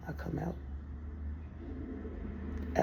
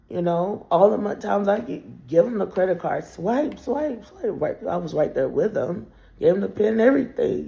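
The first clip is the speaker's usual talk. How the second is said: ordinary talk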